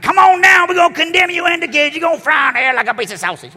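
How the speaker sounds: high, loud mock fire-and-brimstone yell; hellfire preacher. Mocking the old preacher who condemns the crowd, shouting high. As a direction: ham up the old screaming preacher. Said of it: "One of those guys".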